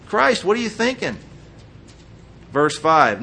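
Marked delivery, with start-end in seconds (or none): 0.1-1.2 pitched-up incredulous scoff; mock exasperation. Brothers mocking Jesus, exasperated scoff. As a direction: scoff at him like a pushy brother.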